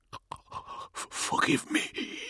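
wet, strained gurgling whisper. A narrator's reading. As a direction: choke the words out through fluid. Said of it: "he gurgled".

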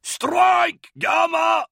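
put-on full-throated high battle yell in a thick accent. A narrator's reading. Captain yelling to his strike team, thickly accented shout. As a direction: bellow the order in a thick, foreign-sounding accent. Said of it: "yelled in his thick Vergestite accent".

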